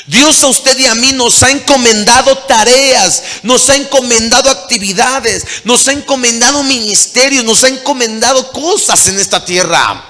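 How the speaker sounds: loud, high preaching at full force; preacher driving the call home, loud and high; fiery preaching. Acted. Preacher urging the congregation, loud and high, at full force.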